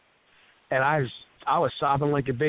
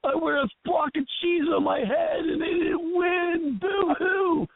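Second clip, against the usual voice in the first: very high, drawn-out fake wail; mock crying fan; slower than usual. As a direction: mimic a bawling girl, hammed-up. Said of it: "and this girl's crying".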